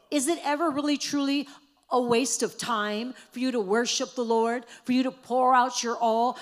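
loud rising sermon cry; preaching at full force. Acted. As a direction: preach it out to the whole room, building. Preacher builds to a climax.

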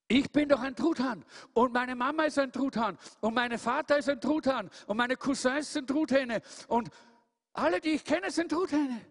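hoch und lauter, abwehrend; empört die Träume abwehren; aufgebrachter Truthahn. Acted. Tom aufgebracht, hoch, laut. (Tom wehrt die Eule ab, hoch und empört)

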